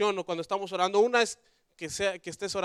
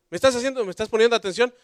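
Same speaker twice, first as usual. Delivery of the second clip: loud, higher mock wife's demand; 4 semitones higher, 9 dB louder, faster than usual. Wife challenging her distracted husband, loud and demanding. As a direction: play a wife insisting on being heard.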